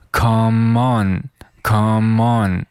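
"come on" slowly run together as "kuh-mon"; linked words. Shown as a demonstration. Teacher demonstrates linking to learners, slow joined "come on". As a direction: link the m of "come" into "on", drawn out. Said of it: "come和on 需要连在一起".

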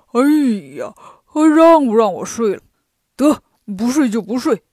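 loud, grumpy pig character robbed of sleep; exasperated Pigsie. A narrator's reading. Pigsie pecked awake a second time, exasperated. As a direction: groan and give up on sleeping.

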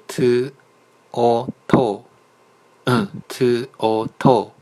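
very slow letters, then blended syllable; sounding out syllables. Shown as a demonstration. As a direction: spell each sound slowly, then join them. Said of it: "我就往后读了".

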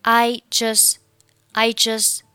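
"just" with its final t dropped; 末尾省音. Shown as a demonstration. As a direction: demonstrate "I just" as "I jus". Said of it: "Just末尾不发音". (just末尾t不发音)

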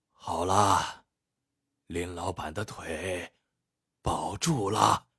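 put-on faint, slow, spent old man; weak and exhausted. Collapsed old healer reporting after treatment, feeble. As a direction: drag the words out with no strength left. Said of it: "有气无力道".

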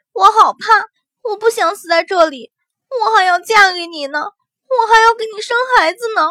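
crying out. Acted. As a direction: wail it in fright. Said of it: "祸水突然大哭出声".

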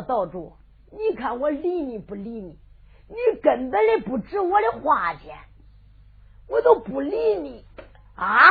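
soft, disdainful and dismissive; scornful. Acted. Wang Neng scorning the island master, soft contempt. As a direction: sneer with a curled lip. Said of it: "王能把嘴一撇".

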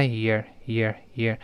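your reduced to a light short sound; your weakened quickly. Shown as a demonstration. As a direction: let your go weak. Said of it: "弱化成your".

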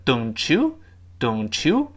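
"don't you" linked, t turning into ch. Shown as a demonstration. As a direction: join "don't you" into one flowing ch sound. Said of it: "我们读成don't you". ("don't you"连读成ch音)

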